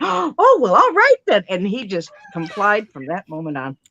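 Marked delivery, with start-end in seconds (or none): 0.0-1.5 mock delighted surprise. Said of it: "And he just went, gasps".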